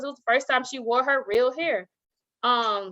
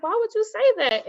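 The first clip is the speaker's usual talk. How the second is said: dismayed question; 9 semitones higher than usual. Retold or imitated. Teacher upset by child's words.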